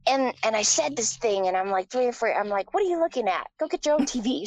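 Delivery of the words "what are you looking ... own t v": put-on high, loud, nasty little-kid jab; mean child taunt. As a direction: sneer it like a spoiled three-year-old. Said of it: "Something really mean".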